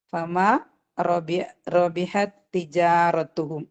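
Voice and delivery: slow chanted verse; slow tajweed recitation; Quran recitation. Shown as a demonstration. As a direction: recite it slowly and evenly. Teacher recites next verse.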